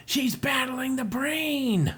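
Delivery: high, slow, stretched-out exclamation; hammy alarm, every word drawn out; high dramatic announcement. Acted. Comrade reporting Samus's battle, high dramatic cry.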